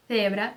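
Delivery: "cebra" begun with English th; tongue tip between teeth on "cebra"; lisped C. Shown as a demonstration. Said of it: "sabe o T H do inglês".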